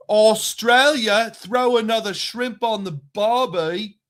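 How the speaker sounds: loud, high, hammed-up Australian accent; mock Australian accent. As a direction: belt it out as a cartoon Australian, stretching the first word. Stock Australian catchphrase after trip news.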